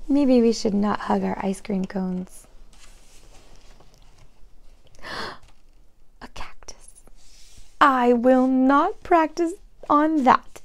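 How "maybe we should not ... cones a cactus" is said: unvoiced amused whisper; hushed giggling aside. Real, not acted. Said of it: "whispered asides".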